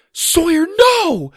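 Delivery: very high, loud desperate cry; panicked scream. Acted. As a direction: scream to stop him. Girl lunges to stop brother.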